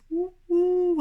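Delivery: high, loud hooting owl call. Acted. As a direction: hoot like an owl in the dark. Owl hooting in dark forest.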